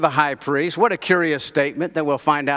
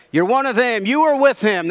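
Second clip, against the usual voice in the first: higher-pitched, pointed accusation; accusing; 6 semitones higher than usual. Acted. Maid to Peter in the courtyard, accusing. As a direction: point the finger at him, pitched up.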